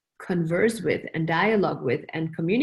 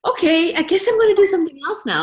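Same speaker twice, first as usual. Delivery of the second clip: higher, louder mock give-up; resigned shrug; 8 semitones higher, 6 dB louder than usual. Deciding to drop the work for now, a louder mock shrug. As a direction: toss it off like giving up for now. Giving up on a scene.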